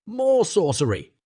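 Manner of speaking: a high mock shout; crowd shouting. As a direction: cry it out from the crowd. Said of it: "shouted the villagers".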